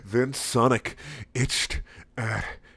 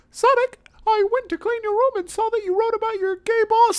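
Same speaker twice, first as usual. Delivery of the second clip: much higher, louder nagging mom voice; hammed-up mom; 4 dB louder than usual. Mom confronting Sonic about his journal, high fussing voice.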